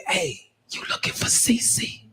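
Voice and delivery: slow, secretive whisper; furtive whisper. Acted. Inmate approaches the man seeking Cece, whispering. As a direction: sidle up with a hushed question.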